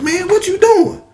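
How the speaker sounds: put-on high-pitched alarmed child. Boy questioning his uncle over the shackled cow, alarmed. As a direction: voice shoots up in mock panic.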